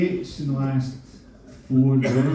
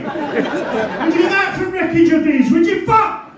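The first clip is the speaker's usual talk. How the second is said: loud, high scoffing retort; high mock outrage; 11 semitones higher, 5 dB louder than usual. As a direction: scoff at the idea, loudly.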